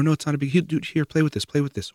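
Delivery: high, loud, hurried coaxing; panicky distraction. Retold or imitated. Parent frantically distracting a crying child, high and loud. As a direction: push a toy at the crying kid in a fluster. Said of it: "they respond with panic".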